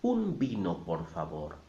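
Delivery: teacher's model of "Un vino, por favor", v's said as b; Spanish pronunciation model. Shown as a demonstration. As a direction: repeat "Un vino, por favor" for learners, b sound for each v. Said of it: "I'll say it again".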